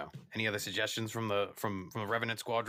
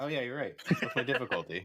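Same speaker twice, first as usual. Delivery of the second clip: laughing; 6 semitones higher than usual. Real, not acted. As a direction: chuckle through the question as it dawns on you. Realizes he forgot to ask.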